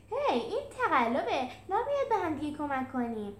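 a loud indignant shout of foul; outraged protest. Acted. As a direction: call out the cheat. Julius protests cheating.

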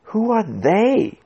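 high, drawn-out irritated question. A narrator's reading. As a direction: forget to whisper and ask it sharply. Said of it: "without remembering to lower my voice".

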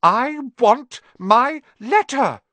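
slow, high, word-by-word yell; loud furious insistence; shout. A narrator's reading. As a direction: shout the demand, stretching each word. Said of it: "he shouted".

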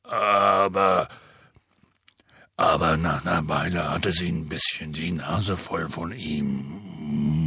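deep rough half-giant voice; low booming gamekeeper; Hagrid voice. Acted. Hagrid speaks.

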